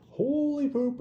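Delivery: high, loud comic astonishment; mock shock. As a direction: exclaim it far up high, hamming it up. Retelling his shock at recognising her.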